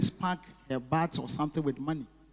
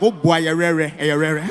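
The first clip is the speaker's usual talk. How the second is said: mock loud cheer; 9 dB louder, slower than usual. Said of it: "The stupid girl was like".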